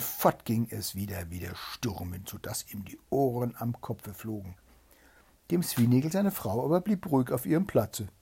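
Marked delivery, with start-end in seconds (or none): none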